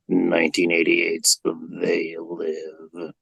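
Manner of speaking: low-voiced drifter impression; George Buck Flower. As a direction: play a low, rambling old movie drunk. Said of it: "doing a wonderful impression of this man".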